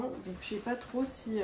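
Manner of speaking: ordinary talk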